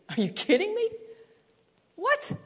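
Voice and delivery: high, squeaky disbelief; incredulous. Acted. Preacher mocking Jonah's anger, high incredulous squeak.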